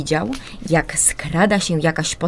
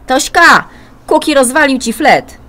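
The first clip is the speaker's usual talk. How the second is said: loud, higher call to his sister; 8 semitones higher, 10 dB louder than usual. Acted. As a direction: shout the news down the hall. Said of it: "Filip zawołał do siostry". (głośne, wysokie wołanie do siostry)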